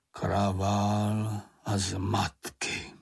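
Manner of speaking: very slow, drawn-out grumbling voice; grumbling to himself. A narrator's reading. Stamp collector alone in the woods, grumbling. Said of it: "něco si pro sebe brblá".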